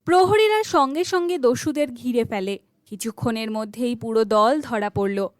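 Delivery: usual speaking voice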